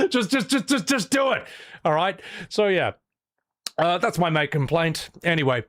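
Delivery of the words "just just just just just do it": breaking into laughter, much higher; laughing. Real, not acted. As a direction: plead while giggling. Laughing pleading.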